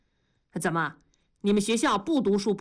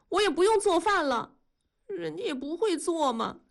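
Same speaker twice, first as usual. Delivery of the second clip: high, plaintive wife's lament; aggrieved complaint; 7 semitones higher than usual. A narrator's reading. Wife complaining to husband about the neighbor, aggrieved. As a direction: whine out her grievances tearfully. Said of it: "委屈地说道".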